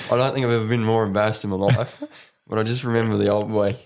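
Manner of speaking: usual speaking voice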